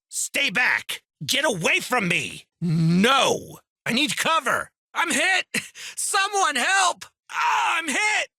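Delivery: high, frantic yelling for help; desperate battlefield panic; panicked cries under fire. Acted. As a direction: scream it like you're pinned down and wounded.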